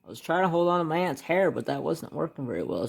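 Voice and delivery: normal talking voice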